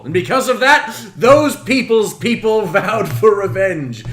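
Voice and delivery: dramatic storytelling. Acted. Dramatic story spine recital.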